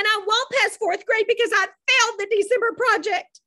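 high, loud overdramatic wail; milk the tragedy for all it's worth; girl dramatizing her failure to her mother; dramatic. A narrator's reading. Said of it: "Caroline said dramatically".

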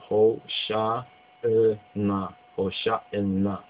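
Hosha'na said slowly with sh and a long n; drawn-out Hosha'na, sh sound clear; careful pronunciation. Shown as a demonstration. Teacher models the Hebrew form for students, slowly. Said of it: "His Majesty's Bible has it in this form".

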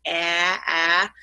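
"ah, ah" as the short open A that starts "antique"; isolated vowel sound. Shown as a demonstration. Teacher isolating the vowel from antique, two separate open A sounds. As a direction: say "ah" twice alone, like the A of "antique". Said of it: "that vowel sound from antique".

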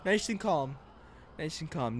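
soft, unhurried self-soothing; nice and calm. Pretend. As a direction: play it cool, voice dropping away. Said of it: "drive away nice and calmly".

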